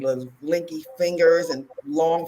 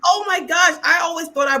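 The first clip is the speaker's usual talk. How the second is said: excited realization; 12 semitones higher, 6 dB louder than usual. Retold or imitated. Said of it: "I was like".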